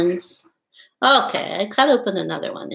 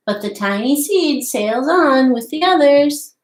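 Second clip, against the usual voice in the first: storybook read-aloud voice, lively and clear; reading to children; 3 semitones higher than usual. Acted. Teacher reading The Tiny Seed aloud to children, lively storybook voice. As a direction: read the tale to little listeners. Reads picture book aloud.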